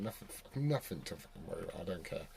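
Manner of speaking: ordinary talk, nothing marked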